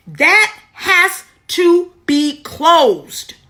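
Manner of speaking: every word of "That has to be closed" separated and weighted; emphatic insistence. Real, not acted. Driving home her spiritual point.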